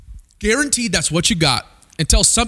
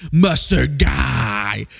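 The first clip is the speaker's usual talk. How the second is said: drawn-out, lower mustard guy; slow and low; 5 semitones lower, much slower than usual. Shown as a demonstration. Preacher models the phrase slowly for the congregation, drawn out and low. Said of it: "Turn to your neighbor and say".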